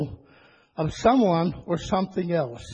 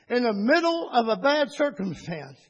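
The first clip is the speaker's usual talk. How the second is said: ordinary talk, nothing marked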